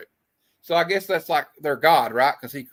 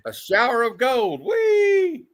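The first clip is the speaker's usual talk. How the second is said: higher, louder joyful squeal; gleeful whee; 9 semitones higher, 5 dB louder, much slower than usual. Acted. Reading a gleeful panel line, high delighted squeal.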